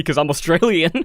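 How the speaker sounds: normal talking voice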